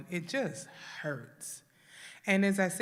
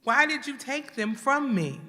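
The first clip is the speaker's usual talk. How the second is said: raised, louder cry of anger; angry at God; 5 semitones higher, 7 dB louder than usual. Retold or imitated. A griever finally admitting to God they are angry, loud anguished cry. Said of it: "until you say".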